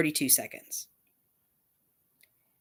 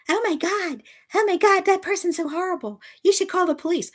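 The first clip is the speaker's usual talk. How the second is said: high, shrill-sounding mock alarm, pitched way up; mocking panicky gossip; 13 semitones higher than usual. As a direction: ham up a hysterical busybody. Mocking a panicky community member.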